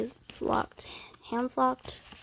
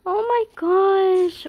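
higher, loud, slow scream of delight; excited scream; 7 semitones higher, 6 dB louder, much slower than usual. Real, not acted. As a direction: let the excitement burst out. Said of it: "why am I screaming so much".

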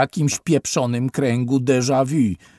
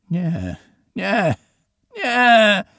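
nie rising to a loud, high scream; scream building; 9 semitones higher, 6 dB louder, much slower than usual. A narrator's reading. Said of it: "wrzasnął kadet". (narastający krzyk, ostatnie nie głośno i wysoko)

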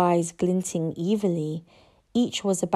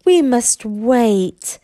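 slow, louder menacing dragon purr; 4 semitones higher, 6 dB louder, much slower than usual. A narrator's reading. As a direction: purr it slowly as a threat. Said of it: "purred Hook Fang menacingly".

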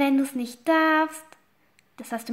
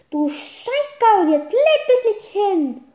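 loud, slow furious yell at the horse; yelling at her horse who lies down; angry yell; 7 semitones higher, 6 dB louder, slower than usual. Acted. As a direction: bellow it at a stubborn horse.

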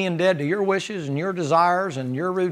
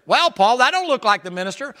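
skeptical challenger; 7 semitones higher, 3 dB louder than usual. Retold or imitated.

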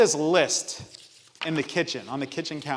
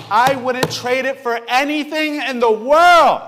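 louder, slower, higher heartfelt declaration; emphatic; 8 semitones higher, 9 dB louder, slower than usual. Real, not acted. Father telling church about his baby, emphatic. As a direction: declare it with full conviction. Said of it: "repeated plainly".

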